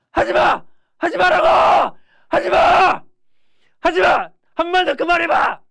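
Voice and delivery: high, full-force repeated shouts; defiant yelling. Acted. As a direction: scream back at your bully, standing firm. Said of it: "소리를 좀 지르고".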